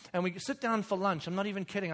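ordinary delivery

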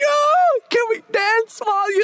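high, excited exclamation; delighted outburst. Acted. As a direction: burst out like a thrilled churchgoer. Acts out delighted congregation member.

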